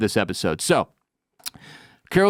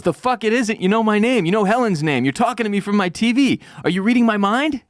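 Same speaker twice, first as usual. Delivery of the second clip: higher-pitched, agitated retort; acted agitated outburst, pitched up; 8 semitones higher than usual. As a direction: fire off accusations in rising disbelief. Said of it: "She's agitated".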